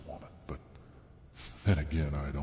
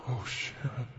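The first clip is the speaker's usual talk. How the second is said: breathless exhale; 8 semitones higher than usual. A narrator's reading. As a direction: sigh it out breathless. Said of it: "I breathlessly exhaled".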